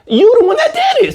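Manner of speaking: high-pitched incredulous retort; indignant scolding. Acted. Partner to crying cheater, high indignant scolding. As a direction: throw the blame back, voice way up.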